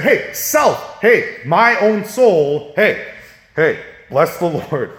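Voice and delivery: loud, high, drawn-out call to his own soul; psalmist talking to himself. Acted. Psalmist calling to his own soul, loud and drawn out. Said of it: "He's saying".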